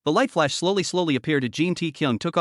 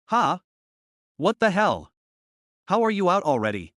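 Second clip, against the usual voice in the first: higher, startled disbelief; shocked; 3 semitones higher than usual. A narrator's reading. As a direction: blurt it out in total shock. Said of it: "totally shocked".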